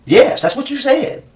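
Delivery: high, slow insistence; insistent. Real, not acted. Interrogator insists, voice jumps up.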